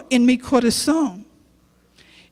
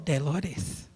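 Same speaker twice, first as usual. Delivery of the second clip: much lower, much softer calling of her name; voice of God; 9 semitones lower, 12 dB quieter than usual. Acted. God calling Dolores by name, much lower and softer. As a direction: call her name low and quiet like God. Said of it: "y Dios me habló".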